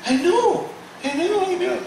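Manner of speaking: high, lightly dismissive I know; retelling a carefree reply, pitched well up; breezy brush-off. Retold or imitated. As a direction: toss it off like nothing's wrong. Brushing off sister's warning.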